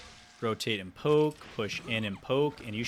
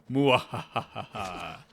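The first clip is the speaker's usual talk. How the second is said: slow, hammed-up villain laugh; evil cackle; 4 dB louder, slower than usual. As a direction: cackle like a cartoon tyrant. Villain laugh after sentencing.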